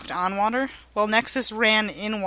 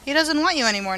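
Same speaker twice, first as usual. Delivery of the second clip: ordinary delivery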